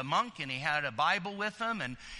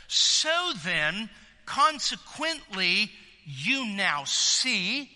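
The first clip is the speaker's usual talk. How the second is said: slow and deliberate; 3 dB louder, much slower than usual. Shown as a demonstration. Preacher spelling out the inference to listeners, slow and drawn out. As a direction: draw out each step of the inference. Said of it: "you could say something even like this".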